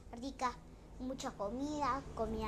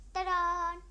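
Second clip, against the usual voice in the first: loud higher ta-da flourish; magic ta-da; 5 semitones higher, 10 dB louder than usual. Acted. Storyteller revealing the spell's result with a loud ta-da.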